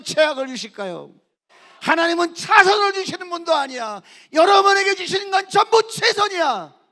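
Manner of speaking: high, loud, ringing pulpit voice; fiery sermon climax; full-force preaching. Acted. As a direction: drive it home to the congregation.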